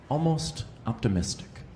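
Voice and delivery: normal talking voice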